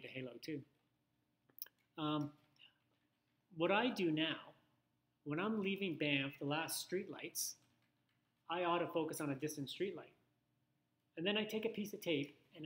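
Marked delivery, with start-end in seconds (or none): none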